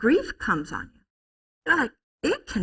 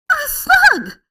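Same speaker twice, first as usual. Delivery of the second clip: high, loud cry of discovery; excited little child; 9 dB louder than usual. Retold or imitated. Small boy finding a slug in grass, excited. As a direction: squeal it like a thrilled toddler. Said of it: "he would go".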